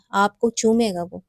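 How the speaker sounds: ordinary delivery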